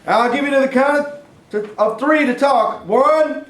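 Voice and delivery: loud, high, slow warning call; shouting. Acted. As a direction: call out into the dark, threatening. Challenging an intruder in the dark.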